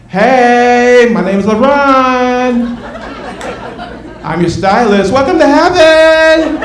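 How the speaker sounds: high, slow and loud, rising on heaven; heavenly stylist voice. Retold or imitated. Stylist LeBron welcoming the newly dead, high and camp. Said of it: "you hear this voice saying".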